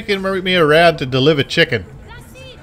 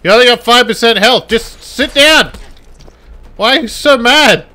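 loud, raised fight-off yelling; exasperated shouting; 6 semitones higher, 4 dB louder than usual. Pretend. Gamer fending off a crazy lady, exasperated shouting. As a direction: shout the attacker down in mock outrage. Pleading with attacker in game.

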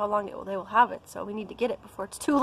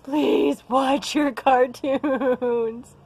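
pleading; 3 semitones higher, 6 dB louder, much slower than usual. Retold or imitated. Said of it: "I'm like".

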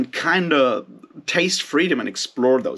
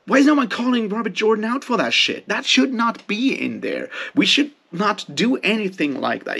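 angry outburst; 8 semitones higher than usual. Real, not acted. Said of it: "Just made me super fucking angry".

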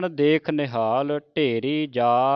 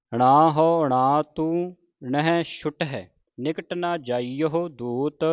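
slow, drawn-out Gurbani recitation; scripture recitation; 3 semitones higher, 4 dB louder, slower than usual. Shown as a demonstration. Teacher models scripture for the congregation, slow measured recitation. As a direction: recite each word slowly with long vowels.

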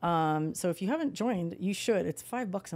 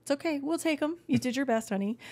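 high, sweet, teasing comfort; sweet reassurance to husband; 7 semitones higher than usual. Retold or imitated. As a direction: pat him on the head with your voice. Teasing her husband about blurry pictures.

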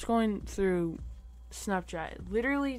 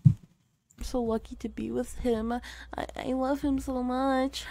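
put-on girly Snapchat gush; 5 semitones higher than usual. Repeating the girlfriend impression for his laughing friend, gushing. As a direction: swoon over him like a lovestruck girl. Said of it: "such a good impression".

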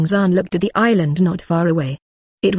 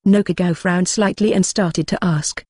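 ordinary delivery, nothing marked

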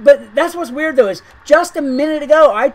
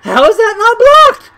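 retold disbelief; 9 semitones higher, 9 dB louder than usual. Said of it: "and I was like".

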